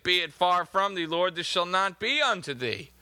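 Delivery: usual speaking voice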